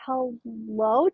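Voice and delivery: usual speaking voice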